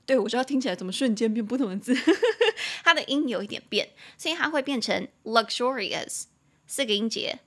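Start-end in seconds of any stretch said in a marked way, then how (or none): none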